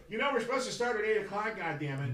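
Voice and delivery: lightly voiced impatient colleague; impatient co-host. Co-host complaining the show must start, mock annoyed. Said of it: "co-host ... go".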